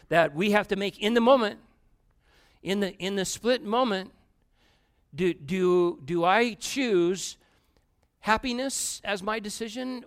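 loud, high, building sermon; fervent preaching. Acted. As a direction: drive the point home from the pulpit. Preaching at full force.

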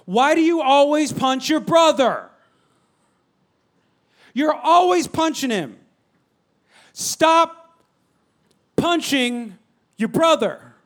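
loud, slow scolding with stress on always and brother; scolding parent. Retold or imitated. Parent scolding son who punched his brother, loud and slow. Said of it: "I'll say something like".

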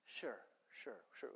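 very soft, low mobster murmur; quiet dismissive lie. Retold or imitated. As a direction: imitate a husband quietly brushing off his wife. Voicing Michael lying to his wife.

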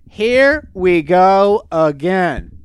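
very slow, loud, each word stretched; drawn-out exclamation. Real, not acted. Host greets a repeat lawsuit story, loudly drawn out. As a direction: announce it word by heavy word. Weary reaction to sequel lawsuit.

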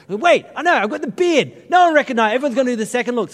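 high, loud burst of a sudden idea; excited realisation. Retold or imitated. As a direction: blurt it out, pleased with the plan. Acts out sudden disguise idea.

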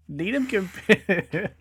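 slow, words cracking up with laughter; laughing. Real, not acted. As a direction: lose it mid-word. Breaking into laughter.

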